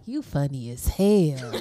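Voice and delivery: low, slow deadpan praise; drawn-out low-pitched deadpan imitation; unexcited. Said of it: "you don't sound excited".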